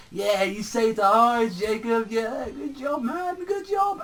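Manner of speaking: high-pitched mock cheer. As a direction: start high and keep rising into a squeak. Acted mocking praise in retold scene.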